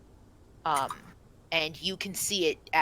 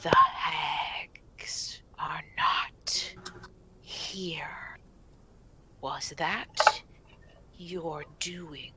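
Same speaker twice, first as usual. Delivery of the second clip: slow, hushed whisper with long pauses; ghostly stag spirit's drawn-out whisper; 4 dB quieter, much slower than usual. Acted. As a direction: whisper it slowly, word by word, as an eerie forest spirit. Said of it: "and whispers".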